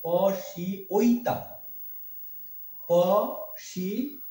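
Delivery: পশিঐতাম said very slowly as po-shi-oi-tam; careful pronunciation. Shown as a demonstration. Teacher showing how পশ্যৈতাম is read, slow syllables. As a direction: separate each sound of পশিঐতাম. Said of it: "এটা কি উচ্চারণ হবে?".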